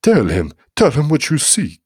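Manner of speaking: loud, rising, insistent order; urgent demand. A narrator's reading. Priest urging Guido Baldo to answer the demon, loud demand. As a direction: press him hard to speak. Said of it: "the priest demanded".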